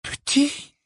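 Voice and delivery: very high, startled question; incredulous echo. A narrator's reading. Hermione throws Hagrid's word back at him, incredulous. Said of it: "répéta Hermione".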